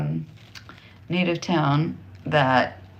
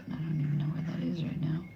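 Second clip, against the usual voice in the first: whispering; 11 dB quieter, faster than usual. Real, not acted. Aside about lost map.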